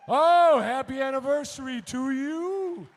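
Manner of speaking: high, loud, delighted wish; excited congratulations. Real, not acted. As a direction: cheer the couple on their anniversary. Congratulating anniversary couple.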